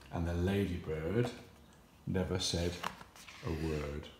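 dropping to a hush. A narrator's reading. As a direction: let it fall almost silent. Quiet line of story.

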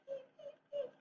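tiny high peeps, very soft; peeper frog peeps. Retold or imitated. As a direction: chirp like a little spring frog. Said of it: "they make a peep".

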